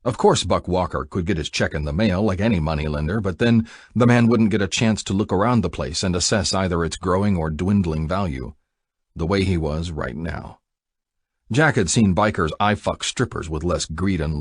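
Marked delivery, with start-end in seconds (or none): none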